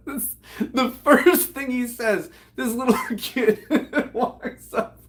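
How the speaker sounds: high words broken by laughter; real laughter while talking. Real, not acted. Teller explains the joke to a kid, laughing helplessly. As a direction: struggle to explain through giggles.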